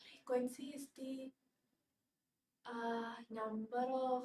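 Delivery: very quiet, low, slow and halting; hesitant student answer. Acted. Student put on the spot by teacher's question, hesitant answer. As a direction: mumble an unsure answer, trailing away. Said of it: "when I ask you what is frequency".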